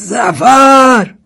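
long, high, loud call of the name; drawn-out high yell; shouted call. A narrator's reading. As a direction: holler for someone far off, drawing the name out. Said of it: "سرهنگ داد زد".

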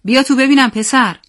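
put-on loud, high call to a boy. A narrator's reading. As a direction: summon him firmly, voice lifted. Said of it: "صدای پدرم بلند شد". (با صدای بلند پدرانه)

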